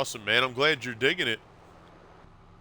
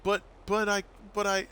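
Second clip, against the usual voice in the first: put-on high stammering girl voice, much higher; flustered daughter; 11 semitones higher than usual. Daughter stuck for an excuse to her dad, high flustered stammer. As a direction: stumble over the words like a caught kid.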